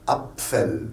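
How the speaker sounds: "Apfel" with P and F both heard; every letter sounded. Shown as a demonstration. Teacher showing there are no hidden letters, every letter sounded. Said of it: "pas de lettres cachées en allemand".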